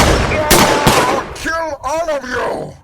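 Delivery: slow, high and loud threat; furious roar. Acted. Croc threatens everyone as he escapes, slow furious roar. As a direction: tear the words out in a rage.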